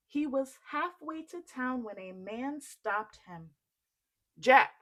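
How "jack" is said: loud hail of the name; calling out. Acted. Stranger calling Jack on the road, loud. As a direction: shout Jack to stop him.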